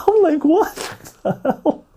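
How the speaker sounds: high squeaky laugh through the words; hammed-up shock, cracking up; laughing disbelief. Laughs acting out his shocked reaction.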